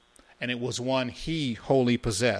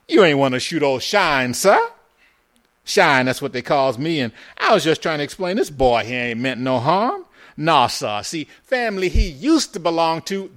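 loud, pitched-up Southern folksy patter; ingratiating old Shine; 4 semitones higher, 10 dB louder than usual. A narrator's reading. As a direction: talk the gunman down with eager, servile chatter. Ben plays the ingratiating Shine.

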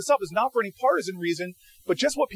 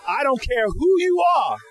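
higher, louder, drawn-out mock scolding; indignant telling-off; 7 semitones higher, 5 dB louder than usual. Woman in the voting line scolds a queue-cutting official, indignant. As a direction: imitate a woman putting someone in their place.

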